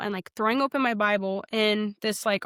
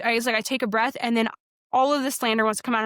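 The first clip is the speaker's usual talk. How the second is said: ordinary talk, nothing marked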